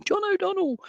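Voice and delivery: high-pitched searching call. Real, not acted. Calling for an audience member.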